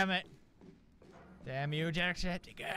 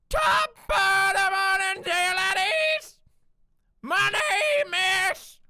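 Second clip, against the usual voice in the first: high, loud, slowing screech; screamed Irish greeting; 14 semitones higher, 9 dB louder, slower than usual. Retold or imitated. Parodying Jacksepticeye at top volume, high screech.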